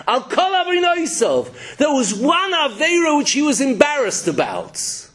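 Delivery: high-pitched, emphatic astonishment; amazed exclamation. Real, not acted. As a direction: proclaim it high, as an incredible revelation. Marvels at an incredible chiddush.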